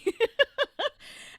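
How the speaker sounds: loud high laugh; bright laugh. Real, not acted. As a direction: laugh at the memory. Laughing at mother-in-law story.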